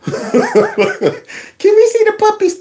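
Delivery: high mock begging voice. Begging fan voice, laughing.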